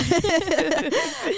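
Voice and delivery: bright, high real laugh falling off. Real, not acted. Host laughs with guest at own joke, high laugh.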